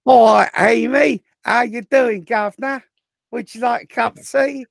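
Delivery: put-on high, cheery caricature of an English accent; hammed-up English accent. Cohost greets fan Amy, hammed-up English accent.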